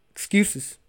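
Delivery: high, mocking squeak on "Excuses". Mocking his own excuses to listeners, high squeak. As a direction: sneer the word "Excuses" up high. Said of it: "a hundred milligrams of excuses".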